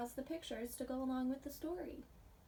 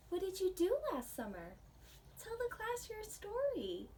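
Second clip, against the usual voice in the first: lighter, higher teacher voice asking the class; higher, bright classroom teacher prompt; story teacher; 8 semitones higher than usual. Acted. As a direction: chirp it out like a cheerful teacher.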